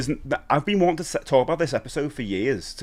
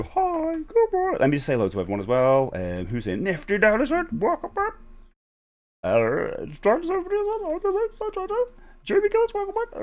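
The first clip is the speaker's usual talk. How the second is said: very high sing-song welcome; falsetto greeting. Acted. Streamer welcomes chat members in a very high falsetto. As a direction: greet everyone in a squeaky high voice.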